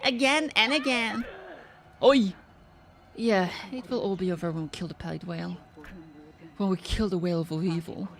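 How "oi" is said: ordinary talk, nothing marked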